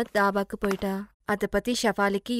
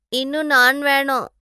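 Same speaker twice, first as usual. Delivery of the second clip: whiny demand; 4 semitones higher, 5 dB louder than usual. Acted. Glutton bride demands more naan.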